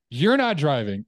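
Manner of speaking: loud firm command; firm loud refusal. Retold or imitated. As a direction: put your foot down. Wife firmly refuses.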